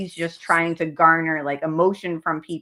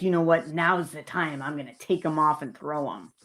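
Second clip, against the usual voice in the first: hushed mock inner thought, softer and lower; 4 semitones lower, 4 dB quieter than usual. Commentator mimicking the man deciding to throw glasses, softer mock voice. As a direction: mimic his scheming thought under the breath. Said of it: "before he decides".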